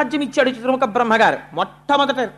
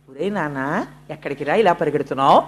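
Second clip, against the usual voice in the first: put-on lower, softer affectionate call to a child; gentle call; 8 semitones lower, 3 dB quieter than usual. Sage Narada gently stopping the running boy, soft and affectionate. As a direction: coax the boy kindly.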